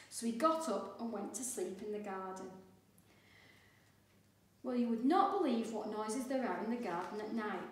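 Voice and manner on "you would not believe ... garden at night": ordinary delivery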